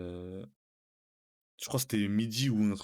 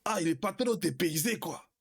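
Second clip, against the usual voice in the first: imitating the instructor's put-on African accent, pitched higher; retold, hammed-up African accent; mock African accent; 6 semitones higher than usual. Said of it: "il commence à prendre l'accent". (imitation moqueuse d'un accent africain)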